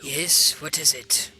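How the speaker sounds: low, put-on commander voice; villain boss. Villain boss answers his henchman.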